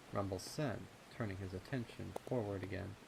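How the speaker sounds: ordinary delivery, nothing marked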